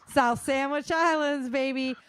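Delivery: a high, loud cheer for the islands; excited. Real, not acted. Host cheering the co-host's favorite quake spot, excited. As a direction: hype up the place like a fan.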